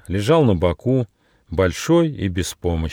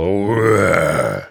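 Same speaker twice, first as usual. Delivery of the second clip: long fierce growling roar; menacing tiger roar; 4 dB louder than usual. Acted. Freed tiger scares off the onlookers, menacing roar. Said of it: "грозно зарычал".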